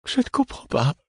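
very high plaintive wail; high, helpless plaint. A narrator's reading. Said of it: "au ciel d'un ton plaintif".

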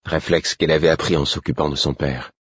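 ordinary delivery

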